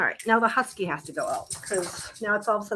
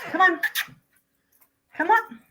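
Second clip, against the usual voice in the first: calling the dogs; 10 semitones higher than usual. Real, not acted.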